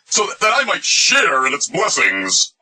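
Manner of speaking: Paul. Acted. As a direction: draw it out high, dripping with irony. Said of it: "what he meant when he said".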